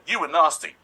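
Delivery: high, loud playful accusation; high-pitched, smiling telling-off; gleeful mock scolding. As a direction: blurt it out loud, grinning as you scold. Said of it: "did it with a massive smile".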